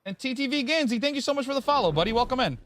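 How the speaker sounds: high, bright streamer greeting; cheerful welcome. Real, not acted. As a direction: welcome them in, pitched high. Streamer greets a new follower.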